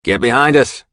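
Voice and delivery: loud urgent command; yelling. A narrator's reading. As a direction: bark it out in a hurry. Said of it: "Dagger yells to Diamond".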